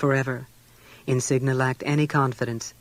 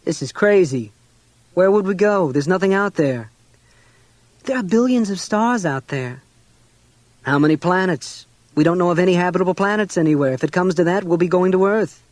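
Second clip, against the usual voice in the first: angry; 4 semitones higher, 5 dB louder than usual. A narrator's reading. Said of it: "reacted with thunderous brow".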